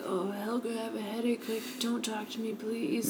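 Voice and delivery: quiet slurred weary mom voice; slurred drunk voice. Retold or imitated. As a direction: mumble it tired and drunk. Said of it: "she talks in this very slow, like, slurred voice".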